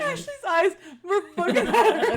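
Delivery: high, loud laughing words. Real, not acted. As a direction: laugh while pointing it out. Laughing at Ashley.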